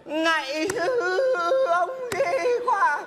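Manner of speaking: high, slow, weepy beggar wail; squished cheeks. Shown as a demonstration. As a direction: whimper it out like a dying beggar. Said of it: "giọng mình phải vật vờ lên này này". (giọng ăn xin rên rỉ, cao và chậm)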